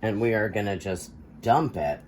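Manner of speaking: usual speaking voice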